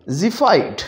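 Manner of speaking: ज़िफ़ाइट said with the X as a Z sound. Shown as a demonstration. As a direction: start ज़िफ़ाइट with a Z. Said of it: "एक्स को हम pronounce कर रहे हैं with the sound Z".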